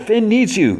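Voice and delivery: high-pitched urgent appeal; retold urgency. Cake tells Fiona she is needed, acted urgency. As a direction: imitate a messenger in a hurry.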